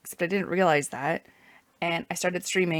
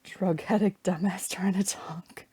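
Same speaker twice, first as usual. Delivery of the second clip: mocking whisper; slower than usual. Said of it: "Hungarian accent is funny".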